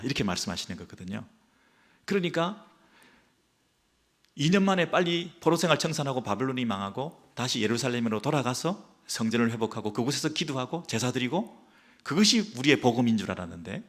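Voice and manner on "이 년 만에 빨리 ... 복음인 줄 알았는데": ordinary delivery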